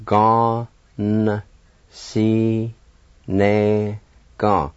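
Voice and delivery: "Gon, si, ne, gon" with long pauses, N sounded; syllable by syllable. Shown as a demonstration. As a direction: hold each syllable of Gon, si, ne, gon apart. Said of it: "Like this".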